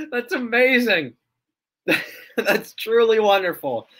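high at first, through laughter; laughing delight. Real, not acted. Streamer admiring the funny live comparison, laughing. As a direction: say it while recovering from laughing.

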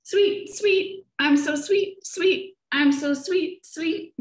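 very high, sweetly sung chirps; sweet warbler song. A narrator's reading. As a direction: trill it sweetly like a songbird. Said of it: "sings sweetly".

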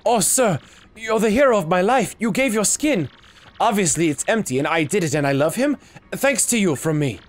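lightly voiced excited admirer; grateful character. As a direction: gush thanks like an admirer.

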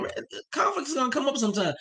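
ordinary delivery

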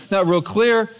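ordinary talk, nothing marked

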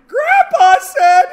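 high, loud, drawn-out mock kid voice; grandchild tattling. Grandkids reporting what Grandpa told them, loud and high. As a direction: stretch it out high like a tattling child. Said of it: "go to St. John and Beth and say".

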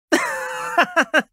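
high, light laughter; happy laugh. Acted. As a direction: laugh happily, pitched high. Happy birthday party invitation. (嬉しそうな高い笑い声)

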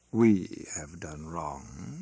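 very slow, mocking lilt with a smile; inquiring smile. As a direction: turn his words back on him, amused. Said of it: "with an inquiring smile".

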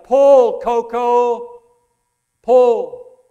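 loud, high yell at a horse. Retold or imitated. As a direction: holler the command across the field. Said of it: "Then he yelled".

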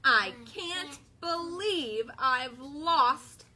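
worried cow. Acted. Bella's lament, children join.

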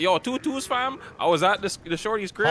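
high Toronto slang accent. Shown as a demonstration. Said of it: "in Toronto slang".

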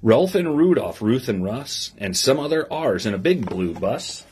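lively storybook reading to a class; reading to children. Acted. Teacher reading a picture book to her class, lively storybook voice. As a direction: read the rhyme aloud with bounce for the kids.

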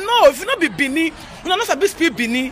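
usual speaking voice

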